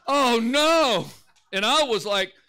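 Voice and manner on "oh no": high, very loud wail of dismay; mock dismayed cry. Preacher imitating the guy whose car is dead, dismayed.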